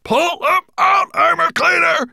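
high, pained roar at a servant; frantic dragon bellow, very loud. A narrator's reading. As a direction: roar the order in agony. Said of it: "he bellowed".

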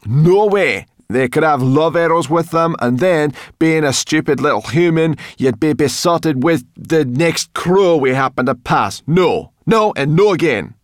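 loud, high-pitched ranting refusal; exasperated scolding. A narrator's reading. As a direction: refuse flatly and tell him off. Sorrel scolds Ben.

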